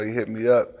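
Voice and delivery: ordinary talk, nothing marked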